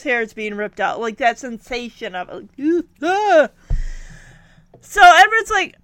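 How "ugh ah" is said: pained wince. Retold or imitated. Mimics feeling nose hairs pulled.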